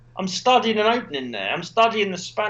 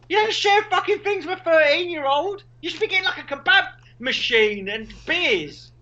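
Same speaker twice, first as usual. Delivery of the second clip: mock outrage; 9 semitones higher than usual. Real, not acted. Mock outrage at dad sharing.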